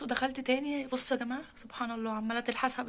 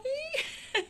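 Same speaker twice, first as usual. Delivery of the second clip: a squeaky burst of laughter; high delighted giggle; 13 semitones higher than usual. Real, not acted. Owner giggling with joy at the kittens, high giggle.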